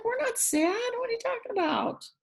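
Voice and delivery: high, puzzled piggy voice. A narrator's reading. Pigs answering the nosy neighbors, high and puzzled. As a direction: innocent little pig, confused. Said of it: "They're like".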